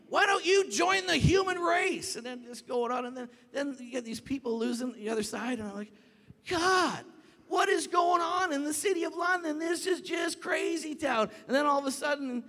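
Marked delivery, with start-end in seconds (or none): 0.1-2.1 very high, loud, slow yell; retold shouting. Pastor yelling back at the cursing girl, exasperated shout. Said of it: "I'm shouting at people". 6.5-11.3 high, loud holler to God; exasperated shout. Retold or imitated. Pastor to God about chaos in the city, exasperated shouting.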